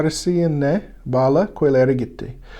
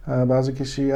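ordinary talk, nothing marked

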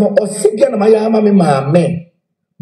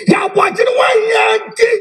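shout; 13 semitones higher than usual. Real, not acted. Heated rant about mother's house.

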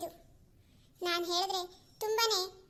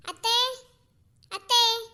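loud, raised call to mother-in-law; raised voice, held final vowels; 3 semitones higher, 7 dB louder than usual. Acted. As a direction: call out as if to another room. Daughter-in-law calls out to mother-in-law.

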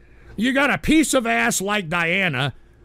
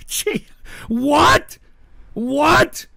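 incredulous what; 6 semitones higher, much slower than usual. Real, not acted. Streamer reacting to princess of our hearts, incredulous. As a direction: pitch it up in disbelief. Disbelief, repeated question.

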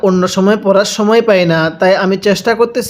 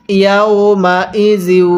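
slow, connected Quran syllables; measured teaching recitation; model recitation; slower than usual. Shown as a demonstration. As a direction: show how it reads, stretched out. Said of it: "পড়ুন".

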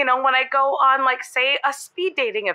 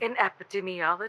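quiet lower repeat; 5 semitones lower, 8 dB quieter than usual. Shown as a demonstration. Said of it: "You say".

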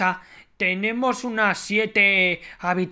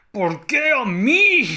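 despairing wail; 5 semitones higher, 3 dB louder, slower than usual. Pretend. Despairing complaint.

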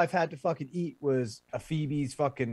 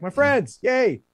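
relieved cheer; 8 semitones higher, 4 dB louder, slower than usual. Retold or imitated. Relieved to see friends.

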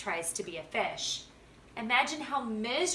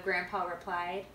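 ordinary delivery

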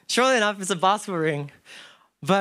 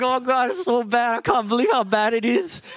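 mock weeping lament; 8 semitones higher, 6 dB louder than usual. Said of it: "the old folks are on their knees weeping".

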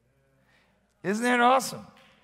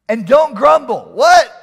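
mock surprise; 5 semitones higher, 11 dB louder, slower than usual. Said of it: "mock shock at verse".

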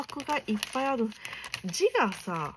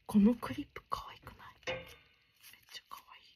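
fast delighted whisper; hushed gushing over a cute clip; 4 semitones higher, much faster than usual. Real, not acted. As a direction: squeal it silently. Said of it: "あんまり大きい声で叫べれないのがね".

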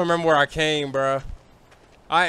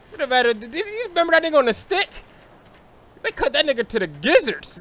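ordinary delivery

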